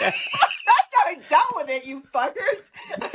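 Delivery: high, drawn-out and laughing; slow, high mock rant through laughter; mock outburst. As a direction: play the snap, laughing through it. Acting out her snapped reply.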